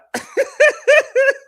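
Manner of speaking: a big high cackle at the memory; laughing loudly at a memory. Real, not acted. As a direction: burst out laughing.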